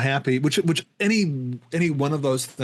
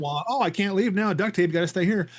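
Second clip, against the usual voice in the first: higher, lightly hammed sitcom whine; comedy version; 6 semitones higher than usual. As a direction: play it for laughs, pitched up. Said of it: "in, in a comedy".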